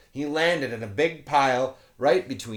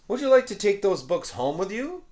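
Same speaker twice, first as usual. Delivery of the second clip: kind volunteer; 10 semitones higher, 5 dB louder than usual. Acted. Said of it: "the volunteer asked George".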